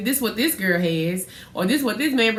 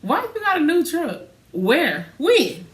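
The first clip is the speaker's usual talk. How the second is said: retold disbelief; 7 semitones higher, slower than usual. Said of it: "I was like".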